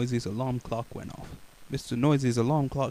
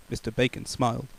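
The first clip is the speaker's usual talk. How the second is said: usual speaking voice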